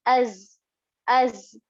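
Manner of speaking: "Az" with a strongly buzzed z; buzzing za. Shown as a demonstration. Teacher showing how za is pronounced, buzzing "Az". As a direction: close "Az" on a voiced, buzzing z. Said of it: "pronounced as a buzzing sound".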